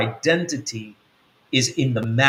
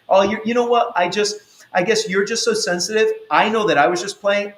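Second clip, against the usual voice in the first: mocking gaslighter; 7 semitones higher than usual. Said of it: "gaslighter acts dismissive".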